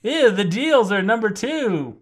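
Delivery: imitated radio voice, high and slow; Clint McElroy. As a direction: stretch it out like a radio promo. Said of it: "radio voice when he's like".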